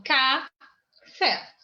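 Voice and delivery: café broken into "ca", pause, "fé"; stress on the last syllable. Shown as a demonstration. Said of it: "Guardem a palavrinha café".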